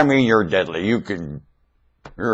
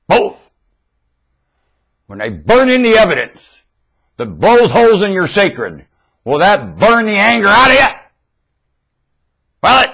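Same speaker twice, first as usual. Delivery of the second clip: loud, high preaching at full force; fiery preaching; 9 semitones higher, 5 dB louder than usual. Acted. Preacher challenging his listeners, full-force loud preaching. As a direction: hammer it home like a preacher on fire.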